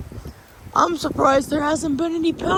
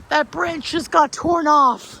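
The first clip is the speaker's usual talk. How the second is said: normal talking voice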